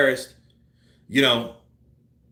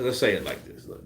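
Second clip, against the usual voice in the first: ordinary delivery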